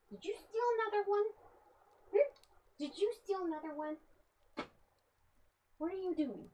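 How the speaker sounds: high, fast cooing at the pet; pet talk. Real, not acted. High pet talk asking the cat about the stolen dice.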